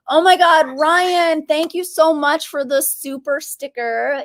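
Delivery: high, loud burst of delight; excited thanks. Real, not acted. Streamer to a viewer who gifted, excited. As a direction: exclaim it to a generous fan.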